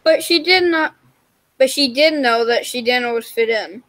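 ordinary delivery

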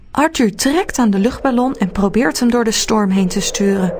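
usual speaking voice

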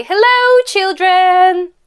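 high, loud, drawn-out hello; long held call to a group of children; called-out greeting. Shown as a demonstration. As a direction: sing out a greeting for a pupil to copy. Models greeting for pupil to call.

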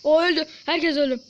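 sudden loud shout about the wipe. Real, not acted. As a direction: yell it out in shock. Team wiped out in game. (bağırarak)

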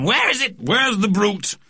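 put-on high, agitated old man's cry; shouting old man. A narrator's reading. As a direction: call out as a blustering old gent. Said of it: "waving his walking stick in the air and shouting".

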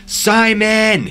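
long, high-pitched searching call; yelling. A narrator's reading. Lone hiker on the trail searching for Simon, yelling. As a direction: holler the name out into the distance. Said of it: "just yelling".

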